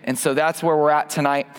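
ordinary talk

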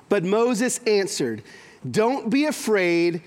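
slow, deliberate reading of the verse; solemn Bible reading, slowed right down; slow scripture reading. Acted. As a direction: let each word of scripture land slowly.

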